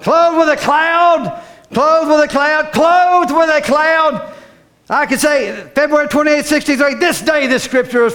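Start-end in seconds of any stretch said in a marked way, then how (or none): 0.0-4.3 slow, leaning on with; clothed WITH a cloud, drawn out; stress on with. Shown as a demonstration. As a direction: drag out WITH, then cloud.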